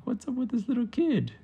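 high, squeaky baffled gecko; high-pitched cartoon bafflement; puzzled gecko. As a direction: ask it like a bewildered little pet. Puzzled geckos voiced in story.

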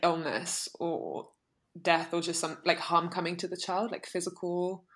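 ordinary delivery

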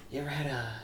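hushed, creepy neighbor murmur; a creepy neighbor softly offering fruit; creepy whisper. Retold or imitated. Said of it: "he's whispering real creepy to me".

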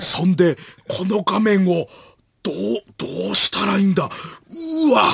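hammed-up bewilderment; 伊集院光. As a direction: puzzle aloud in halting theatrical bursts. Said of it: "伊集院光さんが". (大げさに戸惑う伊集院のものまね)

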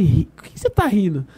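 retold, mildly indignant challenge; a touch of mock anger; annoyed. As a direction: snap lightly at someone for laughing. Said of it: "aí eu fiquei bravo".

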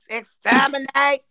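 staccato robotic Dalek chant. Shown as a demonstration. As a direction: spit it out like a Dalek. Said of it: "You sound like a robot".

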